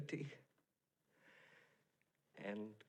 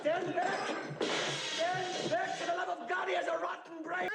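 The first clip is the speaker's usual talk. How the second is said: higher, urgent shouted warning; alarmed warning shout; 9 semitones higher than usual. Acted. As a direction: hold them back from the monster. Warning crowd about monster.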